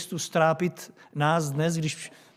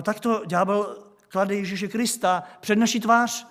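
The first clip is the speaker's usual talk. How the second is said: ordinary talk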